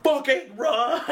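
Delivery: high-pitched mock panicked cry; acted warning yell. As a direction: imitate yelling for people to flee. Kid warns others of danger.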